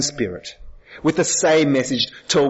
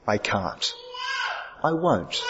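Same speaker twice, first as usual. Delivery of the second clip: flat refusal; 8 dB quieter, slower than usual. Acted. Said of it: "Thomas very flatly says".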